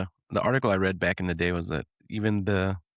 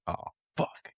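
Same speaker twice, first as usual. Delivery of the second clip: breathy hushed "oh fuck"; whispered curse; 9 dB quieter than usual. Retold or imitated. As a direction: mutter it like a caught kid. Kid caught, whispered curse.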